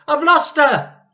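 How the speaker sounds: high, loud frantic cry; wife crying out in panic; panicked wail. Acted.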